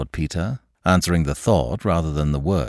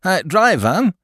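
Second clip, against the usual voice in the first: calling out; 12 semitones higher, 5 dB louder, slower than usual. Acted. Hailing a taxi in traffic.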